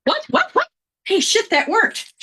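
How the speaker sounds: be amazed it worked; surprised baby. Retold or imitated. Baby discovering screaming gets milk, startled then delighted.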